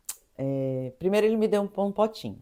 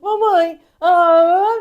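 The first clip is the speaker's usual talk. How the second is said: high, loud tearful wail; comic re-enacted sobbing call; mock crying; 8 semitones higher, 9 dB louder, much slower than usual. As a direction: bawl for mum, hammed up. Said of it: "eu já tava chorando".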